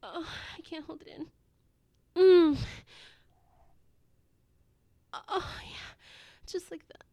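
moaning, sighing delivery, swelling on the exclamations; breathy arousal. Acted. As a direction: gasp and moan between breathy phrases. Acted intimate scene, aroused.

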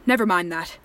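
normal talking voice

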